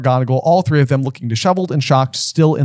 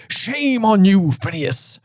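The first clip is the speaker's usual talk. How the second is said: put-on scolding old wizard; 6 semitones higher, much slower than usual. Old headmaster scolds Phineas.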